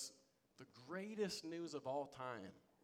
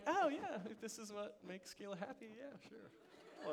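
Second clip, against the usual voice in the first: half-hearted yes; 7 semitones higher than usual. Retold or imitated. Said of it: "kinda like fifty-fifty".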